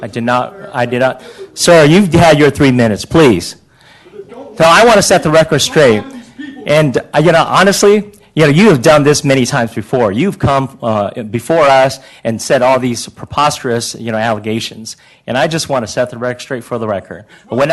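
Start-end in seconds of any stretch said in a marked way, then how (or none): 1.5-12.8 suddenly louder and higher, cutting in; calm turning angry. Real, not acted. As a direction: snap back at him, growing heated. Mayor cuts off heckler, heating up.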